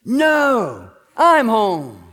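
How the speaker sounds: loud, booming husband announcing himself; hammed-up husband voice. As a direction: boom it out as the returning husband. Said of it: "the deep voice of her husband".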